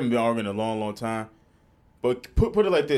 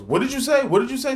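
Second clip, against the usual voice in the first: high-pitched imitation of an affronted neighbor; acted-out incredulous retort; mock indignant challenge; 11 semitones higher than usual. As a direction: pitch it up, challenging someone's remark. Acts out neighbor challenging the lady.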